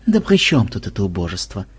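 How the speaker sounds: scornful snort. A narrator's reading. Madam dismissing a small-time looter, scornful. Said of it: "фыркнула мадам".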